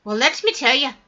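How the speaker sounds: usual speaking voice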